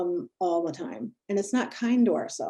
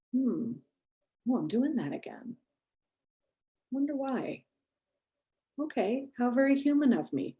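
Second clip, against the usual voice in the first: curious self-talk; 5 semitones higher than usual. Shown as a demonstration. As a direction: gently wonder aloud at yourself. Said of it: "saying it with curiosity".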